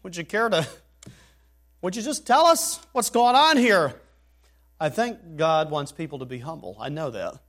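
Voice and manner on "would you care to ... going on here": exasperated demand. Retold or imitated.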